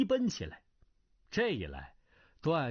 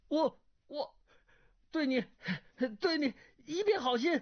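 higher, halting earnest plea; stammering young man; 12 semitones higher than usual. A narrator's reading. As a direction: stumble over a sincere plea. Duan Yu voiced, stammering. (结结巴巴的真诚辩白)

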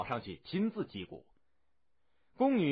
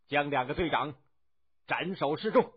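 firm, raised execution order; stern command; 3 semitones higher, 5 dB louder than usual. A narrator's reading. General orders both captains executed, stern command. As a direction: pass sentence as a command, in two parts. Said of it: "于是孙武下令".

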